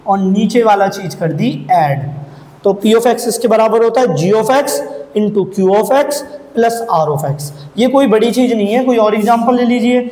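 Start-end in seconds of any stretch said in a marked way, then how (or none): 2.6-7.5 slow dictation. Shown as a demonstration. Dictating the formula slowly.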